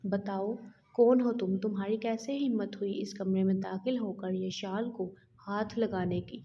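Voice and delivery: plain reading voice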